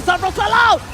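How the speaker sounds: loud, high hype play-by-play; fighting game announcer. Real, not acted. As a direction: shout the fight call like a caster. Caster yells during teamfight.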